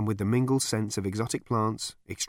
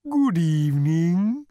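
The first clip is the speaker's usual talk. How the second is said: high, slow, mooing cow; courteous cow, drawn-out and high; lowing cow voice; 8 semitones higher, 6 dB louder, slower than usual. A narrator's reading. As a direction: stretch it out like a polite cow. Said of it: "it lowed".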